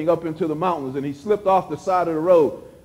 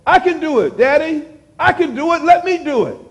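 lightly voiced eager child, pitched up; a child insisting, lightly played; preacher lightly plays a small child asking Daddy to let it try; little child; 8 semitones higher than usual. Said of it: "the little child that says".

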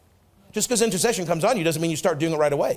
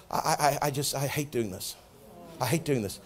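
weary reluctant murmur; 7 semitones lower, 9 dB quieter than usual. Retold or imitated. Retold weary phone calls to wife.

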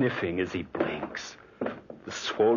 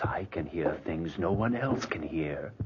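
normal talking voice

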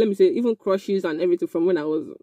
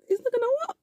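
It's not gonna work, much higher and softer with a rise; a sing-song, rising not gonna work; mocking high take; 6 dB quieter, faster than usual. Shown as a demonstration. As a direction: tease it up high. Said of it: "repeated line, different takes".

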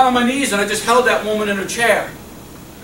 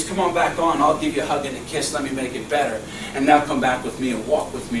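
tender coaxing voice of God; 7 semitones lower, 5 dB quieter than usual. Acted. God voiced tenderly calling back.

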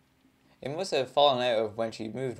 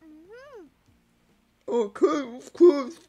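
high squeaky voice with mouth full; squirrel voice; 13 semitones higher, slower than usual. Acted. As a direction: squeak it like a chipmunk with cheeks stuffed. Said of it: "just imagine she's a squirrel".

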